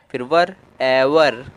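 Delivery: "वर" then "एवर" said slowly, built up syllable by syllable; slow pronunciation demo. Shown as a demonstration. Teacher sounding out "एवर" for Hindi-speaking learners, slowly. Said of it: "तो इसलिए ए".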